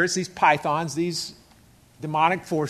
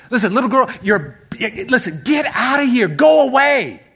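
exasperated dismissal, rising higher and louder; annoyed; 4 semitones higher, 5 dB louder than usual. Acted. Apostle shooing away a pestering girl, annoyed. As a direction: lose patience and send her off. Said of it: "Paul was annoyed".

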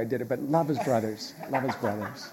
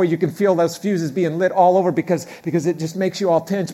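ordinary delivery

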